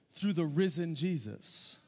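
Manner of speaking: ordinary delivery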